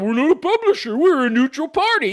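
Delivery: high, loud parroting voice; jeering high imitation; mocking quote. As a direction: parrot them in a silly high voice. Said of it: "You're going around saying".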